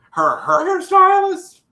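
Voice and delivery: high, loud incredulous squeal; shocked disbelief. Real, not acted. Recapping Catfish to viewers, squeals in loud disbelief. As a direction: cannot believe this claim. Incredulous echo of absurd claim.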